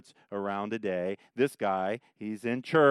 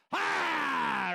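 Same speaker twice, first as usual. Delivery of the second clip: high demonic scream; demon yelling. Acted. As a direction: burst out in a demon's yell. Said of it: "He yells it out right there".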